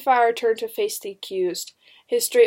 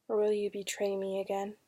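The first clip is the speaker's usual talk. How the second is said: very soft, dropped-away question; bitter inner doubt; 9 dB quieter than usual. A narrator's reading. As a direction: let the doubt sink to a murmur. Doubting thought after the pleading questions.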